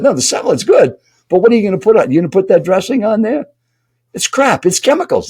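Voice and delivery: high, insistent mock tirade; imitated yelling. Stranger objects to salad dressing, high and half-yelling. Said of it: "He's kinda yelling at you".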